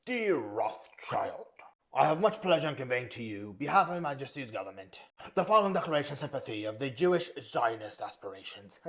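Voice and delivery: hammed-up posh British accent, reading a letter aloud; mock a stuffy British official with a bad British accent; terrible British accent. Reading the Balfour letter to Rothschild in a terrible British accent. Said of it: "I'm gonna do it in a terrible British accent".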